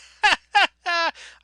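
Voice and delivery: sudden high burst of laughter; big laugh. Real, not acted. Gamer laughing at his own quip, a big high laugh. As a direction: laugh out loud at your own joke.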